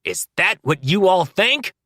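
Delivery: high, loud challenge; shouting. A narrator's reading. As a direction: shout it at the men. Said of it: "Rosewood shouts at them".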